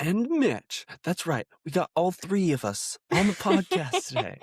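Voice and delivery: high, hyped show announcer. Acted. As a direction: announce it like a host intro. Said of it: "I like your announcer voice".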